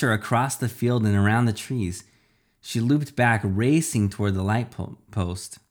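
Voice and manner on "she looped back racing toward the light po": ordinary delivery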